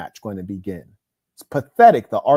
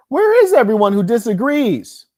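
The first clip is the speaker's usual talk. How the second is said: taunting call; 8 semitones higher, 7 dB louder than usual. Real, not acted. Taunting absent critics.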